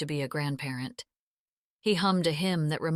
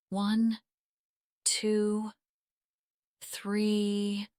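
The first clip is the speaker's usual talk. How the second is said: higher, steady counting; breathing coach; 3 semitones higher, much slower than usual. Acted. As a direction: count her through the breaths. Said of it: "the coaching".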